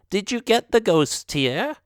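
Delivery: put-on high old witch asking; curious old witch. Voices the witch.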